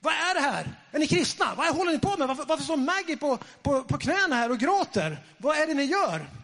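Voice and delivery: high-pitched indignant tirade; scolding questions, pitched high; retold angry screaming. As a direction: act out the angry boyfriend's outburst. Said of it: "Han bara skrek".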